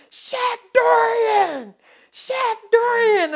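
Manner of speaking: high, drawn-out shout across the parking lot; calling out. Retold or imitated. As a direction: call out her name from afar, excited. Lady calling out from wheelchair.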